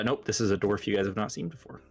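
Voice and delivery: ordinary talk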